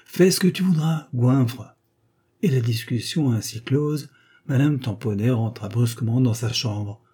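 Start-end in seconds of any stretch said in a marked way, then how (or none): 0.1-1.8 higher-pitched parting insult; cross dismissal. A narrator's reading. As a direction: dismiss them, fed up. Wife ends the quarrel with insult. (renvoi agacé)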